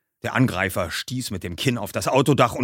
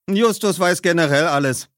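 Bob, teasing; 8 semitones higher, 3 dB louder than usual. A narrator's reading. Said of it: "bemerkte Bob".

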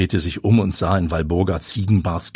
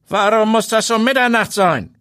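high eerie watchman voice; 4 dB louder than usual. A narrator's reading. Watchman challenges Habe.

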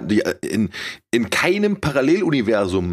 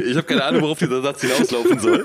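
laughing; 5 semitones higher than usual. Real, not acted. Laughing at own sentence.

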